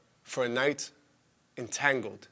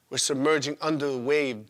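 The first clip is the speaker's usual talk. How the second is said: plain reading voice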